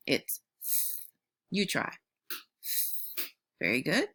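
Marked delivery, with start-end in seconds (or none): 0.6-1.0 "s" as a long airy th; tongue between teeth, blow "s" out as voiceless th; th sound. Shown as a demonstration. Teacher modelling for pupils to try, "s" as breathed th. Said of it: "blow air in between your teeth to make the sound".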